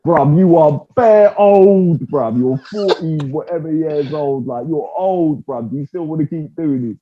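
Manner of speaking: mock Cormier taunting the old fighter; imitating Daniel Cormier. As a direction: rib him about his age, hammed-up. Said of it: "first words that came out of Daniel Cormier's mouth".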